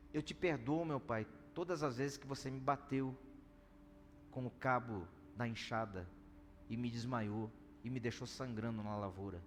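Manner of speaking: a man quietly forgiving his father, understated; tearful forgiveness. Retold or imitated. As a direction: let it come out hushed and heavy. Man recounting father's beatings.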